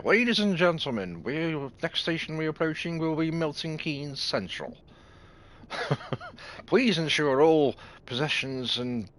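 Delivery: a hint of a slow, formal train conductor announcement; lightly mock the station announcer, drawn out. Game face on, hamming up the announcement to passengers, slow mock announcer. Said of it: "you can give it the old".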